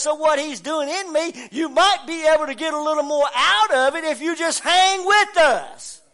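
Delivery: preaching at full force. Acted. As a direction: drive every line home to the congregation.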